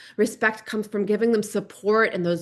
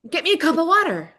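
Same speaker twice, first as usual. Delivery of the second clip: put-on much higher, rude little-kid demand; bossy child; 10 semitones higher, 4 dB louder, faster than usual. Son repeating his order to mom, high and rude. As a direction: sound like a pushy five-year-old.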